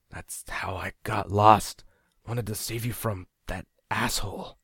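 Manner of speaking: slow breathy whisper, trailing off; whispered mutter. A narrator's reading. Dazed man whispers why he came back, slow and breathless. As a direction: whisper it like you're fading. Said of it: "he muttered".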